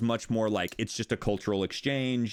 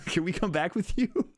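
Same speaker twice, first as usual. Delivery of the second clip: laughing plea; 7 semitones higher than usual. Retold or imitated. Mock plea to foreign fans.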